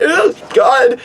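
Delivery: high, squeamish groan; a hammed-up grossed-out squeal; effeminate disgust. Wrapping the bat up, high grossed-out ugh god. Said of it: "I was so effeminate".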